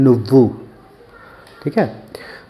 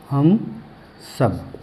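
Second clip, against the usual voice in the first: word by word; 6 dB quieter, much slower than usual. Shown as a demonstration. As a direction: separate हम and सब as if dictating. वही शब्द अलग-अलग दोहराना. (हम और सब अलग-अलग, रुककर)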